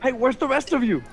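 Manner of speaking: high, quick penguin chatter. Acted. Penguin Kowalski asking the hero where the others are, high and quick. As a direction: rattle it off as a fussy penguin. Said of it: "and goes".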